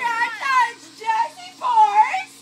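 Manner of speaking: loud, breathless call-out. Real, not acted. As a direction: blurt the challenge out loud. After icy dunk.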